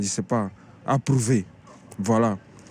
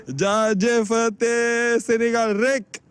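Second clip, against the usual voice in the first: drawn-out fan cheer; 10 semitones higher, much slower than usual. Real, not acted. Fan cheering his team at end.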